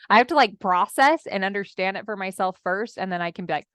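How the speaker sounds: ordinary talk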